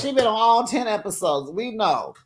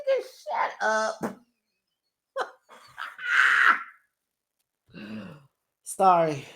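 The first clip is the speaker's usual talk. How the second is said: laughing; 2 semitones higher than usual. Real, not acted. Laughing at the show's line.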